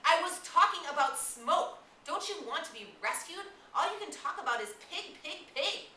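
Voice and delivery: loud, high, exasperated shout; indignant outburst. Acted. Ralph to Jack, fed up with pig talk, loud indignant shout. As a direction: lose control and shout him down. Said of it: "indignation took away Ralph's control".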